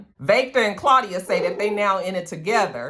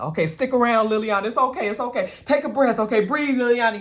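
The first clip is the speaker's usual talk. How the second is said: higher, urgent, coaxing Tommy; Tommy comforting; 6 semitones higher than usual. Retold or imitated. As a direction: voice Tommy begging her to hang on, pitched up. Tommy comforts dying Liliana.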